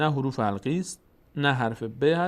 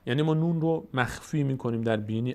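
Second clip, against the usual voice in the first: ordinary talk, nothing marked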